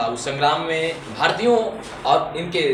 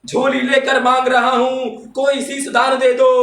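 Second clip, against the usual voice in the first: high, louder poetic appeal; fiery declamation; 10 semitones higher, 6 dB louder, slower than usual. Acted. Reciter begging the audience for sacrifice, fiery declamation. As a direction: make it a ringing public demand. Verse repeated at full force.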